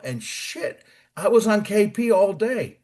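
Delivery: normal talking voice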